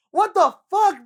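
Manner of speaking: high, very loud outrage; yelling at a careless friend; outraged yell. Retold or imitated. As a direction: blast it in disbelief. Friend lets him vomit on lap.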